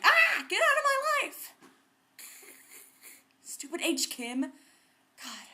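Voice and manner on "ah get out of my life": put-on high, loud, slow teen shriek; mock yell. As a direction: shriek at the sibling to leave. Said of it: "and I was like".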